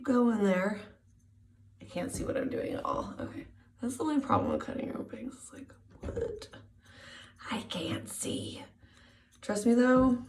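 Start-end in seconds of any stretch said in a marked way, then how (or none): 3.8-8.6 whispering. Real, not acted. Whispering while cutting blind.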